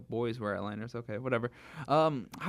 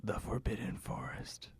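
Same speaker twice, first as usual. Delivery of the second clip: mock ominous whisper; 4 semitones lower, 9 dB quieter than usual. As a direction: whisper it, spooky reveal. Ominous ending of the chapter.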